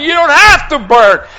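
loud, high counter-cry; retold desperate plea; urgent pleading. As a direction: shout back to save him. Pleads back over his yelling.

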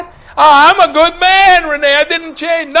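high, shouted retold argument; wailing insistence; loud protest. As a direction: protest at full voice.

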